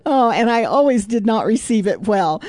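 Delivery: real sheepish laughter; laughing. Real, not acted. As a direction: laugh at your past self. Laughing about past corrections.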